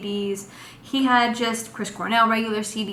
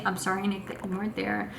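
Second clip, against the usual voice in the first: soft apology; 2 semitones lower, 8 dB quieter than usual. Real, not acted. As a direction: drop the voice for a small apology. Aside apologizing to an absent Nick.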